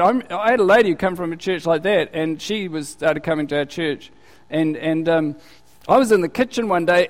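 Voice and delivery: normal talking voice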